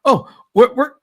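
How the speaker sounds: high, loud, fast mock gushing; sarcastic overexcited voice, racing along; mock excitement. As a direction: ham up fake delight at each bump. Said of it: "Well, look at this".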